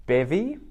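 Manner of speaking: "Bevy" high and rising, BEV-ee; "Bevy" lifted high, stress on BEV; new word. Shown as a demonstration. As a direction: present Bevy brightly. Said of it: "you guys can try guessing these".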